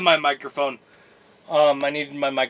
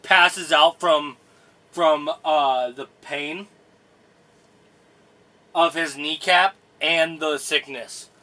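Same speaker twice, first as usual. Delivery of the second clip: normal talking voice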